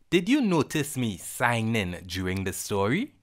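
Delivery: ordinary delivery, nothing marked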